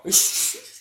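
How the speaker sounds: squealing laugh, loud; giggling at the countdown joke; high burst of laughter. Real, not acted. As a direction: let out a shrill laugh.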